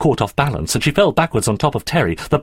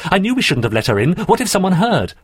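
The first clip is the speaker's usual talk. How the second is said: rushed, rising worry; alarmed; 9 semitones higher, faster than usual. Acted. Terry frets to Andrew after the scream, alarmed. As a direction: fret urgently about being caught.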